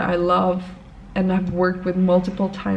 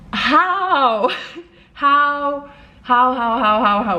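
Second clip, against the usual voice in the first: exasperated how; 5 semitones higher, 3 dB louder, much slower than usual. Retold or imitated. Exasperated at the unready necklace.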